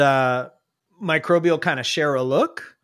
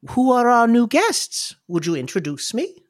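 Clarity; 7 semitones higher than usual. Acted. Older lady asks after new guests, high kindly voice. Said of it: "She says".

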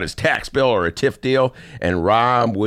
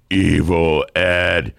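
mock sinister name; 5 semitones lower, much slower than usual. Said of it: "spooky naming again".

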